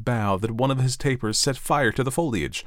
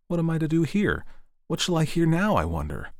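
a hint of an eager young tree, pitched higher; the tree's daydream, a touch higher and hopeful; lightly voiced fir tree; 8 semitones higher than usual. The fir tree dreaming of the evening, lightly hopeful.

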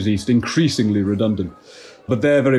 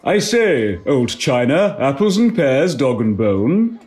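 mock cockney accent, higher pitched; cockney slang attempt; 4 semitones higher, slower than usual. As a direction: ham up cockney rhyming slang, slow at the end. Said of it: "not fully fluent, but I will do my best".

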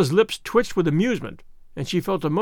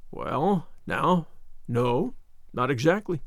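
hesitant, slightly lower and quieter reply; uneasy; 3 semitones lower, 4 dB quieter, slower than usual. A narrator's reading. Matthew backing down before Marilla, mildly uneasy. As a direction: hedge with mild unease. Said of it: "said Matthew uneasily".

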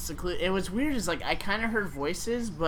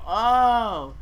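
retold realization; 6 semitones higher, 8 dB louder than usual. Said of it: "And I go".

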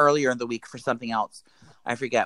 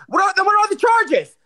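very high, loud screech; demonstrated screaming; 5 dB louder than usual. Shown as a demonstration. Showing how they screamed at the pharmacist, outraged screech. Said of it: "how you actually screamed".

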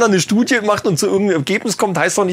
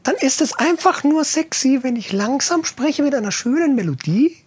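high voice; 8 semitones higher than usual. Shown as a demonstration. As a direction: talk up high with a lilting melody. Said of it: "mit einer ganz hohen Stimme spreche".

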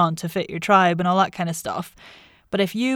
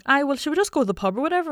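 higher, quick Manchester student chat; Manchester uni accent; 8 semitones higher, faster than usual. Retold or imitated. Student chatting to uni friends about the pub, in Manchester accent. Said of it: "I was in uni at Manchester".

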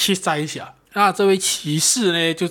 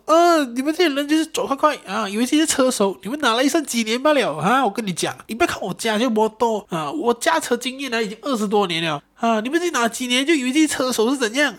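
much higher, lecturing, hammed-up scorn; brother's mock scolding; 8 semitones higher than usual. Older brother lectures the driver at the clinic, mock scornful scolding. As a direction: talk down to a reckless kid. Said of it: "他哥哥又在我旁边继续屌我".

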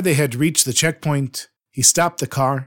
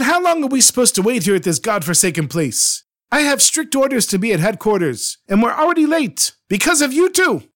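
confident, impatient; 8 semitones higher than usual. Acted. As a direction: play the offended officer who is running late. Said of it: "he continued speaking in a confident tone".

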